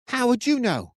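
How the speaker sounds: high, angry shout of disbelief. A narrator's reading. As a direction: hurl the question back at him. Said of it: "he shouted".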